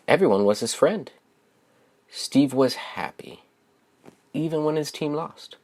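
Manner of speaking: plain reading voice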